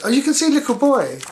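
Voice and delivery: high, loud delighted oh; excited pointing. Real, not acted. Reader showing the child the boy in the picture, excited.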